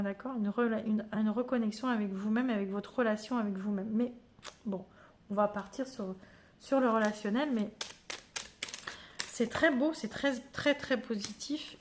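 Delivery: normal talking voice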